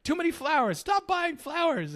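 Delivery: high-pitched imitation of a scolding yell; mock yelling. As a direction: act out a nagging yell, drawing out the end. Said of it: "Sugar was yelling at him".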